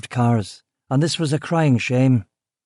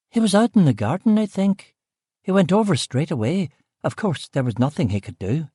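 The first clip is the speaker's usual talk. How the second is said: high Belfast voice, fading; earnest Belfast cook; 5 semitones higher than usual. Acted. Cook recounting Sir Harry's morning, in a higher Belfast accent.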